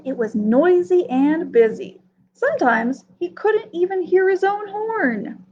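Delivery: ordinary delivery, nothing marked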